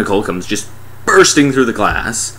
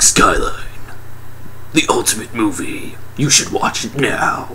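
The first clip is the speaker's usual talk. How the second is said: movie trailer announcer. Retold or imitated. Mimicking the Skyline trailer, mock announcer. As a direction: intone it like a mock film trailer. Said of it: "in the trailers they were like".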